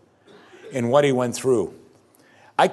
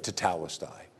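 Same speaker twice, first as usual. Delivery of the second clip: Tetelestai barely above a breath, very soft; dying man's final whisper-soft word; hushed last words; 5 semitones lower, 12 dB quieter than usual. Acted. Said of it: "Right before he gave up his spirit".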